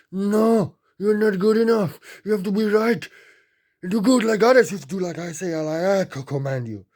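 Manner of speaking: a high, loud parental scream of no and have to; furious scolding parent. Retold or imitated. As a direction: yell it down at a scared child like a raging parent. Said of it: "Still screaming at you".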